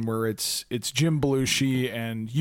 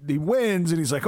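ordinary talk

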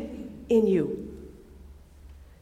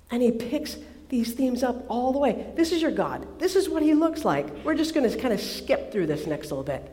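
usual speaking voice

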